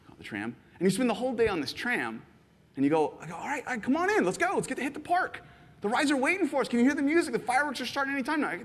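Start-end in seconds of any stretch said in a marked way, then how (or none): 3.3-8.5 high coaxing pitch; urging excitement. Retold or imitated. Host urging the visitor into the park, high and eager. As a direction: sell the park with eager energy.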